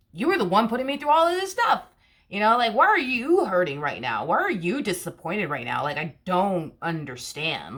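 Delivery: indignant, leaning on the key words; exasperated disbelief. Acted. Confronting the person who put you through it, exasperated.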